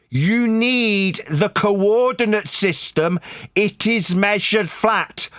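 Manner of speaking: very slow, word-by-word delivery; slow insistence. Real, not acted. Debater repeating a point to opponent, slow insistence. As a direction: spell it out slowly as if to someone dense.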